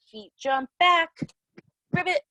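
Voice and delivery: normal talking voice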